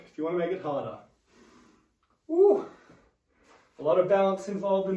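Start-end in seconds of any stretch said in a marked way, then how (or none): 2.3-2.7 excited whoop. Real, not acted. Cheer after exercise set.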